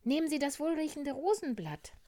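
high, loud, quick bear voice; little bear offering. Acted. Little bear offers rose petal. (hohe, eifrige Bärchenstimme)

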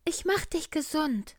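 a kindly cartoon bear, high and loud; little bear. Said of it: "sagte der kleine Bär".

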